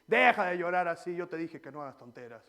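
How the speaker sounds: father telling off his son; scolding father. Retold or imitated. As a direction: bark at the crying boy like a fed-up dad.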